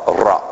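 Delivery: Ra with a trilled R; rolled R. Shown as a demonstration. Preacher showing the congregation how to roll the R. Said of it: "Hebrew, you roll the R".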